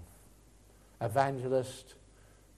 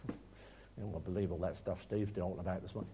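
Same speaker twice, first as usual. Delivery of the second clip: low, quick, muttered mock of a doubter; dismissive scoff; 8 semitones lower, 10 dB quieter, faster than usual. Preacher voicing a doubting listener, low scoffing mutter. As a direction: brush it off like a sceptic in the pews. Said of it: "you're gonna respond this morning".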